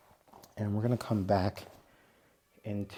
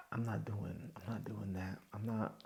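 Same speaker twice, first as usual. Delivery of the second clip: whispered refusal; 9 dB quieter than usual. Real, not acted. Refusing friends' request.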